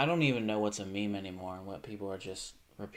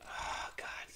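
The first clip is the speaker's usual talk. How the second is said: dread whisper; 9 dB quieter than usual. Real, not acted.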